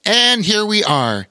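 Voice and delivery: high princess voice; storybook princess. A narrator's reading. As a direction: announce the arrival as a princess. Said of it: "said Princess Laneda".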